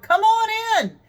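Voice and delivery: put-on high, loud, playful Russian accent call. Instructor calling through the locked door in a Russian accent. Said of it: "in that adorable little Russian accent".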